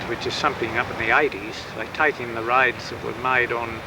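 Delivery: ordinary talk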